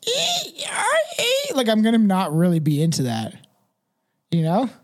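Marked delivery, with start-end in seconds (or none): 0.1-1.5 much higher shrill squeaking squeals; mocking a lover who squeaks like a chair, much higher; squeaky chair squeal. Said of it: "sounds like a squeaky chair".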